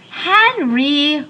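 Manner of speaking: high drawn-out wail of his name. A narrator's reading. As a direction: cry out his name in distress. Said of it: "wailed Denise".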